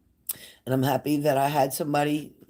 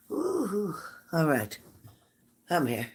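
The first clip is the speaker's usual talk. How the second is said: normal talking voice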